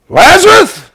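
high, booming call by name; shouted summons. Acted. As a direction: shout to wake the dead. Jesus calls the dead man out.

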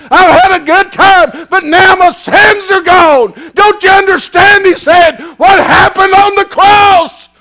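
slow refrain swelling into a high, loud cry; fiery revival preaching at full force; preaching building to a shout. Acted.

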